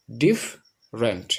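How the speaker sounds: ডিফারেন্ট broken slowly into "dif... rent"; syllable by syllable. Shown as a demonstration. Teacher showing two syllables, ডিফারেন্ট split slowly as "dif... rent". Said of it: "যেহেতু দুইটা syllable বিদ্যমান".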